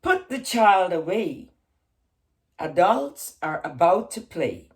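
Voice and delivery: slow, measured haiku reading with long pauses; poem reading. Acted. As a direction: let each image settle before the next. Reads her haiku aloud.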